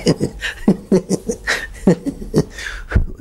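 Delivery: breathy bubbling laughter; woodcutter's laugh. Acted. Woodcutter bursting with long-held laughter, breathy giggle. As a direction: let pent-up laughter burst out. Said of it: "कौन हंस रहा है?".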